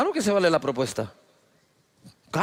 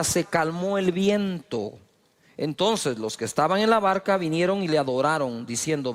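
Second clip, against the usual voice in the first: normal talking voice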